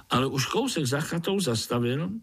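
ordinary delivery

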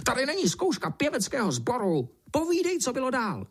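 high, squealing order; shrieking beetle. A narrator's reading. Beetle ordering the ant on, shrieking. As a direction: squeal crossly like an impatient beetle. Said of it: "zaječel černý brouk".

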